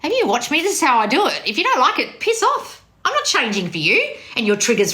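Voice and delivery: high-pitched dismissive telling-off; scolding retort. Acted. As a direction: snap back at the complainer, pitch jumping up. Acted retort to complaining client.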